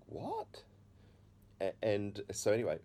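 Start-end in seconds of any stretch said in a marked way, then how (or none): 0.1-0.6 quiet, high-rising disbelief; taken aback. Retold or imitated. Son answers God's odd command, acted quiet bewilderment. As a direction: blurt it softly, pitch shooting up.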